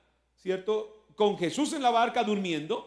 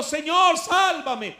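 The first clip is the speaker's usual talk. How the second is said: higher, louder plea for rescue; crying for help; 7 semitones higher, 6 dB louder, much slower than usual. A narrator's reading. Peter sinking in the waves, shouting to Jesus for help. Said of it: "gritó".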